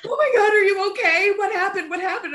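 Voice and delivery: worried husband. Retold or imitated. Husband wakes to her sobbing.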